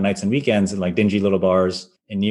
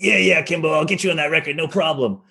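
imitating raspy voice; 6 semitones higher than usual. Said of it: "He had this raspy voice".

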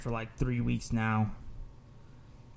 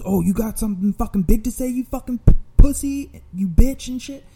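loud, high mock shouting; angry taunt; 10 semitones higher, 9 dB louder than usual. Attacker turns on the delivery man, loud jeering challenge. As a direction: imitate an aggressor, building louder into insults.

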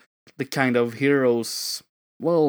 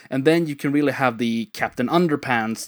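usual speaking voice

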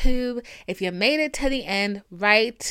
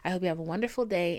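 normal talking voice

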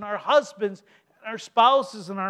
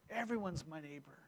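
much softer and lower, almost breathed; near whisper; 7 semitones lower, 18 dB quieter than usual. Shown as a demonstration. Preacher echoing the lesson in a hushed murmur. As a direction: let it fade to a hush. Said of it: "repeated for effect".

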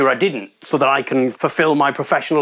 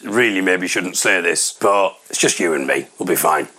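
confiding aside; 7 semitones lower than usual. Real, not acted. Comic confides a secret to audience.

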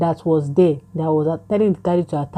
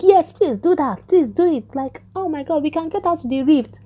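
eager pleading; 10 semitones higher than usual. Retold or imitated. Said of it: "Haley, was like".

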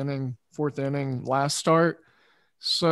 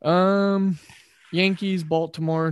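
ordinary delivery

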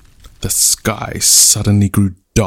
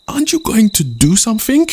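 high, louder exasperated yell; yelling chief; 11 semitones higher, 5 dB louder than usual. Acted. Chief yelling at idle Rain, exasperated. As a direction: demand action from Rain. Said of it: "yelled the chief".

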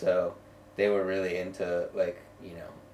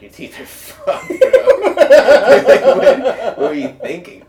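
laughing; 15 dB louder, slower than usual. Retold or imitated. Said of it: "And they were like".

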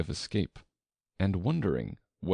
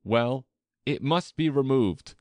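decided; 6 semitones higher, 5 dB louder than usual. Acted. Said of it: "very decidedly".

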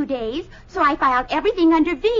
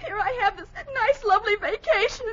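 tearful, broken comic complaint; crying; 5 semitones higher than usual. Acted. Bessie tells her employer about her vacation, tearfully. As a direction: sob your way through the explanation. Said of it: "Why are you crying?".

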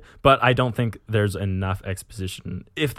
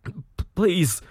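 high, faltering plea; acted agonized begging; stammered plea; 9 semitones higher than usual. A narrator's reading. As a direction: stammer out a desperate please. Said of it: "murmured Andreas".

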